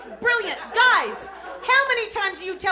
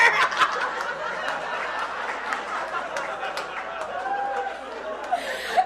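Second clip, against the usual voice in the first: high laughing giggle; 8 semitones higher, 5 dB quieter than usual. Retold or imitated. Kid laughing.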